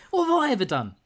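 high, loud protest of innocence; hammed-up wronged squeal; mock indignation. Mocking Zoe's wronged innocence.

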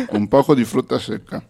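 talking with a full mouth. Real, not acted. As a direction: cheeks stuffed with nuts. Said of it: "la bocca piena, sembri un criceto".

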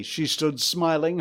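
usual speaking voice

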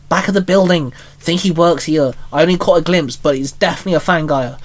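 ordinary delivery, nothing marked

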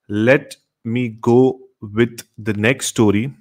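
ordinary talk, nothing marked

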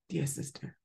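ordinary talk, nothing marked

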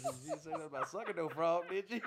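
quoting through laughter; giggling out the question; laughing. Retold or imitated. Quoting Dave while laughing.

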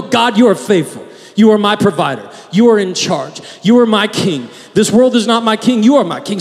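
firm declaration, stressing you; a steady spoken creed; preaching to yourself. Shown as a demonstration. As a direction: declare it to your own heart. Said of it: "this is a practice of preaching to yourself".

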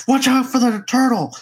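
high-pitched imitation of an excited coach; urgent sideline coaching. Coaching a player from the sidelines, urgent imitation. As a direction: call out warnings like a backseat gamer. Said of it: "trying to coach him".

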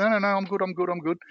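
high, quick "I'm fine" protest; squeaky eager refusal; flustered reassurance. Retold or imitated. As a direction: brush off the offer with nervous brightness. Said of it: "And I'm like".

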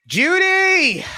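loud, high call of a name. Real, not acted. Host calling Judy up to speak, loud high call. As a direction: shout her name across the room.

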